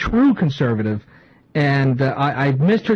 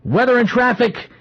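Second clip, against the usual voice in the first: upbeat station ID read; booming DJ intro voice; radio announcer; 6 semitones higher than usual. Acted.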